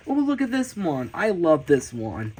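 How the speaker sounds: normal talking voice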